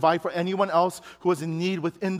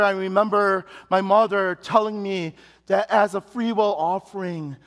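ordinary talk, nothing marked

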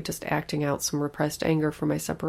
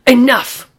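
sharp, much louder snap; sudden shout; 8 semitones higher, 16 dB louder than usual. Acted. Frazzled mother shouting at her pleading daughter, loud. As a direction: cut her off with one loud word. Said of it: "I shouted".

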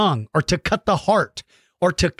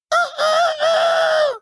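squeaky high shouted clucks; chicken squawk; much slower than usual. Pretend. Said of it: "jumps out and goes".

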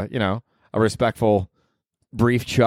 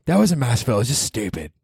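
ordinary talk

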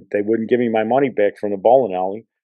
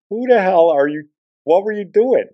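imitating the partner's stunned, much higher "who the hell are you"; retold astonished outburst; 10 semitones higher, 4 dB louder than usual. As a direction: pitch it way up like a baffled bowling partner.

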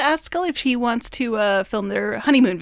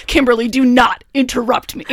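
louder, emphatic mock scolding; mock irate boss; 6 dB louder than usual. Skinner to his secretary at the office door, mock irate. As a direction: play an annoyed boss cutting off an interruption.

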